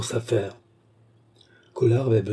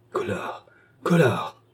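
ordinary delivery

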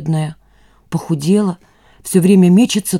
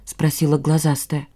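plain reading voice

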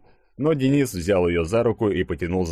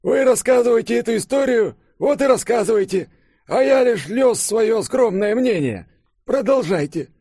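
put-on very high, louder cranky old-man voice; querulous old man; 5 dB louder than usual. A narrator's reading. Old professor under the table scolding Konstantin, querulous. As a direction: scold like a grumpy elder, pitched way up. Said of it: "ворчливый старческий голос".

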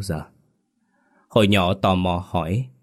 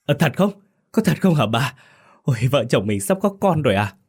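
cheering; 7 semitones higher, faster than usual. A narrator's reading. Husband overjoyed at news of a baby, high excited cry. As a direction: burst out with joy. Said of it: "Ông reo lên".

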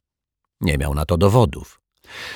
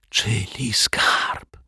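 slow breathless whisper; 4 semitones higher, 5 dB quieter, much slower than usual. Acted. Intrigued janitor to the detective, slow awed whisper. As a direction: whisper it in awe, slowly. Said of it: "szepnął zafrapowany".

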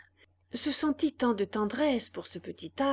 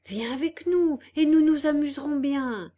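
louder, higher, eager; tempting friend; 6 semitones higher, 7 dB louder than usual. A narrator's reading. Candlewick tempting Pinocchio to come along, eager. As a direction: lure him with fun.

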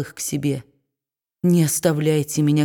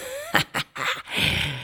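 gloating cackle; 8 dB quieter than usual. A narrator's reading. Gleeful dwarf outside.

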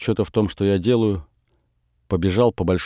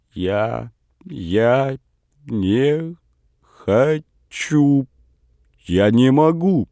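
halting, broken words on the edge of tears; holding back sobs; 5 semitones higher, much slower than usual. A narrator's reading. Boy refuses grandmother, fighting back sobs. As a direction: choke the words out slowly, about to cry. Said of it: "сдержанные рыдания".

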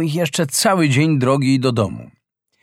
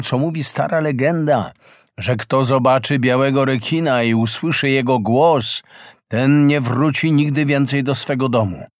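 ordinary delivery, nothing marked

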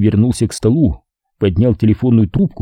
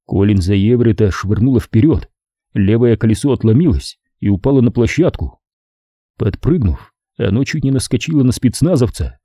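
ordinary delivery, nothing marked

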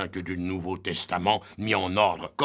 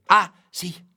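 exclamation aiguë et forte; soudain ravi; 10 semitones higher, 8 dB louder than usual. Acted. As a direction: comme une idée qui éclate. Ubu se souvient soudain. (ah soudain, aigu et fort)

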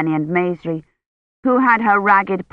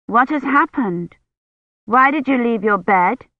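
mildly anxious, higher-pitched questioning; 5 semitones higher than usual. A narrator's reading. Girl asks Kivrin why she left bed, mildly anxious. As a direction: press her with a touch of worry. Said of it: "she asked anxiously".

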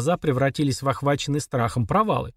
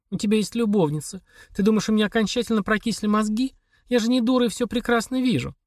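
a hint of a higher, pleading wife's voice; lightly voiced wife Olga; 8 semitones higher than usual. A narrator's reading. Wife demanding a divorce from her husband, lightly voiced. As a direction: read her lines a little higher, worn out and insistent. Olga's voice in the dialogue.

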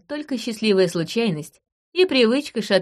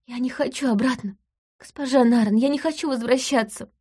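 silent crying; 6 semitones higher than usual. Acted. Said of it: "Дита заплакала. Беззвучно".

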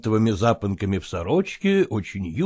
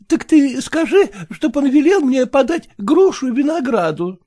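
put-on high, fretful old mother; a doting, fussing mother; fussy mother voice; 13 semitones higher, 6 dB louder than usual.

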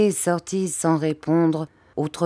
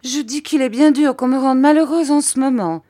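high, louder sulky protest; pouting complaint; 9 semitones higher, 5 dB louder than usual. A narrator's reading. Mother to her new husband, pouting complaint. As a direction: complain with a pout, pitch raised. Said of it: "en faisant une petite moue".

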